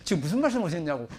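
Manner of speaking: lightly voiced congregant breaking in; mock interruption, quick and loud at the edges; interrupting congregant. Congregant interrupts the pastor's sermon to ask, lightly mimicked interruption. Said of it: "성도님들이 뭐 잘 모르실 때 손을 들고".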